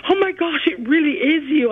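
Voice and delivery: imitating astonished nurses, high-pitched; high, excited mock amazement; amazed recognition. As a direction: gasp it out as a starstruck fan would.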